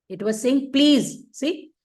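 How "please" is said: "Please" pitched high and falling; stress on Please. Shown as a demonstration. Teacher quoting the verse to devotees, stressing "Please". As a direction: lift "Please" clearly above the rest, then let it fall. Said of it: "Why we have to stress on each word".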